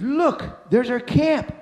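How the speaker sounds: high-pitched cry of discovery; excited cry. Retold or imitated. One hiker spots the camp below, excited cry. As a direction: call out the exciting find.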